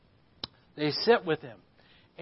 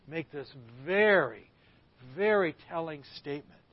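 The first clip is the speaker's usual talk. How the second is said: ordinary delivery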